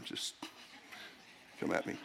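dropped way down, a throwaway dare; quiet teasing aside. Real, not acted. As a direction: toss it off under your breath. Teasing aside after Jordan claim.

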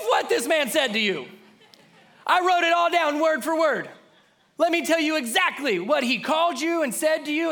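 high, loud, breathless gossip with a laugh; young men bursting to tell David everything; excited messengers. Retold or imitated. Young men report Nabal's insult.

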